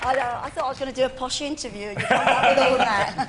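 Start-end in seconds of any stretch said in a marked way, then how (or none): 1.9-3.3 laughing. Real, not acted. Laughing at the host's story.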